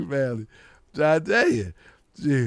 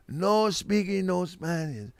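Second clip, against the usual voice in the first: slow, softer mock Spanish accent; fake Spanish accent; 6 dB quieter, slower than usual. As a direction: put on a drawn-out Spanish accent. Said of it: "with a fake Spanish accent".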